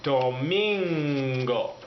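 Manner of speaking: "Domingo" very slow, stretched; slow model word. Shown as a demonstration. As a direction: draw out "Domingo" for learners. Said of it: "say domingo".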